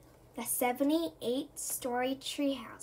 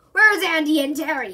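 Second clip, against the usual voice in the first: loud demand from a cross boss; angry yell; 3 semitones higher, 11 dB louder than usual. A narrator's reading. Cross Mister Big Nose demanding the boys, yelling. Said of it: "he yells".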